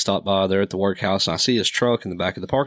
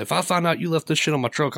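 higher-pitched mock threat; retold threat; 7 semitones higher than usual. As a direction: imitate a worked-up buddy warning someone. Buddy threatening a stranger, retold.

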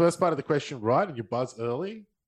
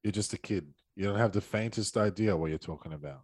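movie quote reading; 8 semitones lower, 7 dB quieter than usual. Acted. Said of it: "What is this from?".

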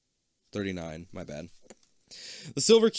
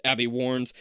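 plain reading voice

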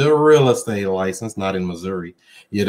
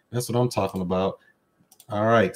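usual speaking voice

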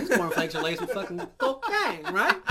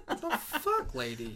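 outraged surprise; 11 semitones higher, slower than usual. Retold or imitated. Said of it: "I'm like".

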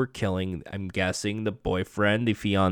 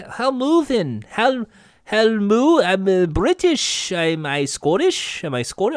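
attempted British accent; 8 semitones higher than usual. Reluctant recapper trying out the villain's voice, shaky British accent. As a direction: badly attempt British, unsure if it's Scottish. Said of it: "try to a British accent".

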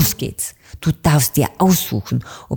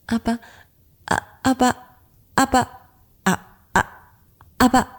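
higher, nervous stutter on aber; stammering; 7 semitones higher, much faster than usual. A narrator's reading. Frightened blackbird stammers back at the little witch. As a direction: stumble over the words in panic. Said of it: "stotterte Anton Amsel".

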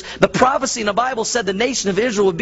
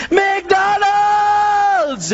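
high, drawn-out excited shout of McDonald's; kid shouting McDonald's; 12 semitones higher, 3 dB louder than usual. Retold or imitated. Hungry kid on a road trip seeing McDonald's, high excited shout. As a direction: belt out McDonald's like a thrilled child.

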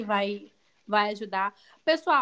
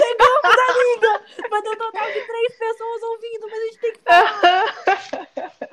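high, loud laughing cry; laughing embarrassed; 11 semitones higher, 11 dB louder than usual. Real, not acted. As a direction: laugh it out, half crying with embarrassment. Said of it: "Ai, que vergonha".

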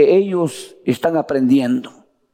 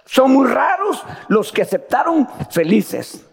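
preaching shout; 7 semitones higher than usual. Real, not acted. Preacher drives home his point.

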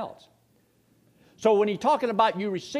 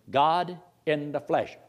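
normal talking voice

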